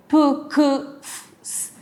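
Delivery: "P, Q, F, S" as bare puffs and hisses, not letter names; "P, Q, F, S" as isolated voiceless consonants; voiceless sounds. Shown as a demonstration. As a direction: make each of "P, Q, F, S" without vocal cord buzz. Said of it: "las cuerdas vocales no vibran".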